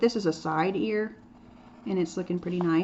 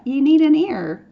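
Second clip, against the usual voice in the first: louder, higher, slower insistence; emphatic insistence; 7 semitones higher, 10 dB louder than usual. Real, not acted. Teacher telling students they need ears, louder and higher. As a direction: drive the point home.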